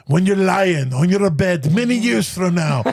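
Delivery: slow start, building to a high rallying cry in Scottish brogue; hammed-up Scottish battle speech; Braveheart Scottish accent.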